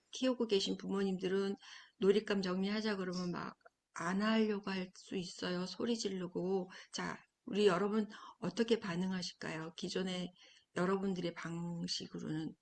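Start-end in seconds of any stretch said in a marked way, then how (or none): none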